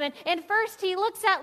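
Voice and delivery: ordinary delivery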